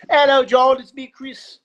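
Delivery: high, loud mock-British phone greeting; British accent. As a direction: imitate a British caller announcing himself. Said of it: "like, the accent or whatever".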